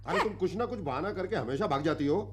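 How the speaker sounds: high, fast telling-off; bossy scolding. Acted. Site boss scolding absent woman, scolding. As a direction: rattle off the accusation.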